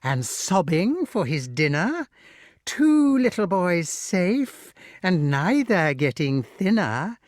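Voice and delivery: slow, sing-song gloating rhyme; drawn-out wicked nursery rhyme; witch counting. A narrator's reading. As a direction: chant the tally in a high witchy lilt. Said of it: "counted Madam Mim".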